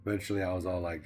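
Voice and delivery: usual speaking voice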